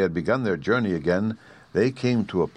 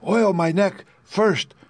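higher, slow, rusty-sounding tin man; pleading tin man, higher and slow; creaky Tin Woodman; 11 semitones higher, 4 dB louder, slower than usual. A narrator's reading.

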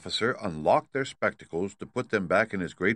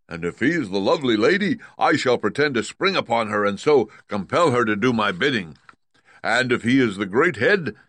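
big, loud lion voice, pitched up; boastful Cowardly Lion; 4 semitones higher, 6 dB louder than usual. Acted. Lion cheering friends with a plan to face Oz, boastful. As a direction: play a blustering lion, loud and sure.

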